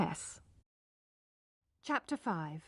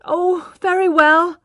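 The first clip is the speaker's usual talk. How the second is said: grudging; 9 semitones higher, 9 dB louder, slower than usual. A narrator's reading. Man grudgingly giving in.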